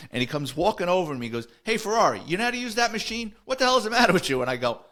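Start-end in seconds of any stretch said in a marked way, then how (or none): 1.6-4.4 high, loud, exasperated imitation; mock sergeant's scolding. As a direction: holler at the rookie across the gym, hammed up. Sergeant hollers at rookie in gym.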